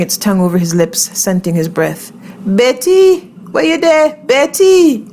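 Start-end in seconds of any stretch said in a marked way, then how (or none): 2.4-5.1 high, drawn-out calls; raised, high voice hailing a friend; calling out. A narrator's reading. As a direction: call out searching for someone in a crowd. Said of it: "calling out Betty's name".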